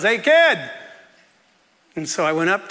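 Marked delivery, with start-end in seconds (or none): 0.0-0.6 short, higher-pitched call-out; retold yell. Teacher yells after student leaving class, calling out. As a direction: yell to catch someone leaving. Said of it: "and he yells".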